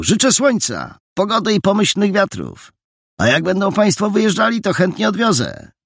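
bow out with exaggerated politeness; gallant boy.

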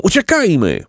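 high, urgent cry to flee; despairing cry. A narrator's reading. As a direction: call out desperately, pitched high. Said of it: "krzyknęli z rozpaczą".

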